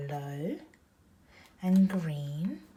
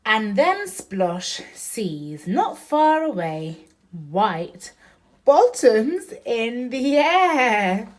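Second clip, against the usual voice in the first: louder, slow, sing-song picture book reading; reading to a child; 4 semitones higher, 12 dB louder, slower than usual. Acted. Mom reading Splosh to Oriana, loud and slow. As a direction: read each line big and slow for a little one.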